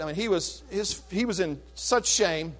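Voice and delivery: ordinary talk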